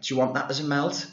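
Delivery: put-on, high server's voice; imitated Subway girl. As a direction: imitate a girl serving at the counter. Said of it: "the girl was like".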